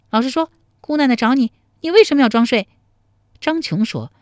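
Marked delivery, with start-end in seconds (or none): 0.1-2.7 high, loud demanding woman; stern interrogation. A narrator's reading. Tao Sanchun grilling the fake sleeper, sharp demand. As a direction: press hard for a confession.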